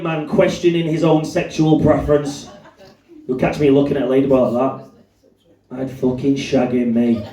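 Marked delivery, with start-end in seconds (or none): none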